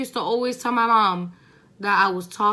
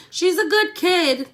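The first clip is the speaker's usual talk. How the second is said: a hint of a sing-song teacher, higher, louder and slow; mock teacher praise; 9 semitones higher, 6 dB louder, slower than usual. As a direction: lightly imitate a gushing teacher. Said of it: "teachers always love to be like".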